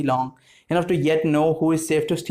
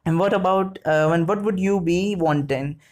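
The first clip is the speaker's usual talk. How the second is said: usual speaking voice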